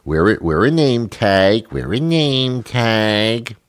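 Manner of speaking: slow, drawn-out sing-song nagging; mock preschool nag, stretched very slow; childish coaxing. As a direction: coax like a teacher to a toddler. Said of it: "this very pure, childish kind of".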